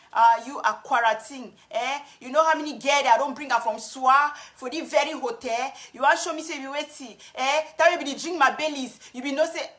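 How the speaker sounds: angry man mocked in Cameroonian Pidgin English. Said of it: "I will talk about pidgin".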